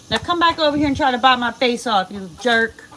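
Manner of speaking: loud, teasing yell at the birds; playful loud taunting. Real, not acted. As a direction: dare them to come back, loudly. Taunting chickens to come bite.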